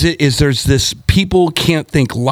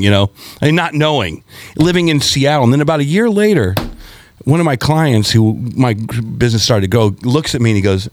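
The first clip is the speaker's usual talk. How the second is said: normal talking voice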